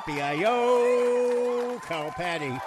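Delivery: high, loud whooping yee-haw; playful loud cowboy whoop to greet the guest; cowboy yell. Acted. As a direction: holler it like a rodeo cowboy. Host whoops on-air greeting.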